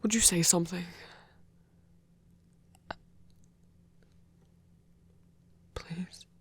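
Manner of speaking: soft pleading whisper, almost gone at the end. Acted. A shy god whispering a plea for the listener to speak. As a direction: beg gently under your breath.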